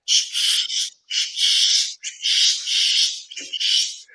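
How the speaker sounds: voiceless shh calls, urgent; rapid whispered shh chirps, desperate; desperate bird chirping. Retold or imitated. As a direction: shush out bird calls, pleading. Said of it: "I started chirping one more time, like, desperate".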